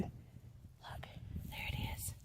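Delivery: hushed whisper over the sprouts. Real, not acted. Gardener to her viewers, whispering. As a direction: breathe it out softly, not to disturb.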